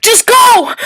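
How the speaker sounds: a loud, urgent command; urgent shout. Acted. Rescuer shouting to the falling friend, urgent. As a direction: yell it as you reach for her.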